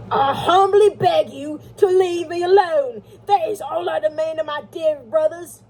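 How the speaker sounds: high, loud, theatrical put-on voice; curt letter-writer, indignant. Estranged brother's note demands solitude, declaimed indignantly. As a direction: declaim the note with emphatic hauteur.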